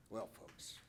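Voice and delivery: words caught in the throat; choked up. Real, not acted. Coach finishing the letter to the audience, choked up. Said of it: "I'm emotional".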